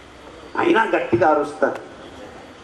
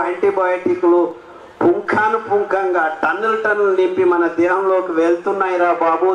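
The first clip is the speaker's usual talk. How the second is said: usual speaking voice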